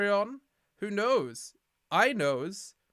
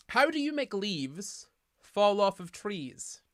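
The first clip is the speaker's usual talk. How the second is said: joke setup; 4 semitones higher, 4 dB louder, slower than usual. Pretend. Telling a proud pun joke.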